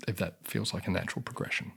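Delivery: ordinary delivery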